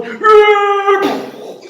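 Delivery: chillido agudo y fuerte; grito de monstruo. Acted. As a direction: grita furioso. (monstruo chilla furioso)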